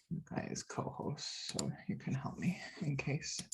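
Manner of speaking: hushed aside under the breath; whispering. Real, not acted. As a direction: whisper it to yourself while busy. Muttered aside while clicking settings.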